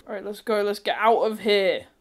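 usual speaking voice

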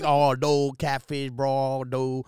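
thick mock Cajun mumble-talk; Cajun accent impression. Imitating Instagram guy who sounds like Farmer Fran, Cajun accent. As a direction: imitate a Cajun rambling to his bro. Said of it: "it sounds like Farmer Fran from".

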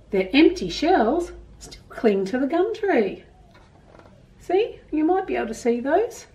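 put-on gentle storybook reading to children; reading a story aloud. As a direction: read it like a picture book to kids. Reads picture book to children.

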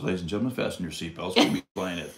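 deep airline captain announcement; low, smooth cabin announcement voice; pilot voice. Acted. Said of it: "we got a pilot voice".

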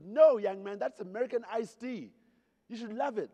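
loud American matron. Retold or imitated. Marge correcting the young British guest, loud brash. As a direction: boom it like a bossy Pittsburgh lady. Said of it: "She goes".

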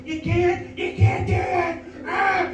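high, shrill-voiced old lady shouting; old woman yelling at a mixed sports fan, mock screaming. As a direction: scream it like an outraged granny.

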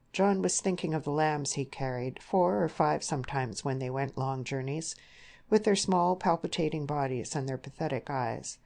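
usual speaking voice

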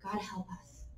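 hushed, desperate whisper to God; whispered plea. Retold or imitated. Israel begging God for help, whispered. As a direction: breathe the prayer out, barely voiced.

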